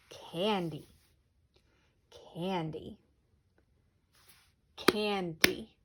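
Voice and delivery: candy said slowly, syllables pulled apart; syllable-by-syllable demo. Shown as a demonstration. Teacher sounds out candy for the class, slowly. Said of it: "So let's make one".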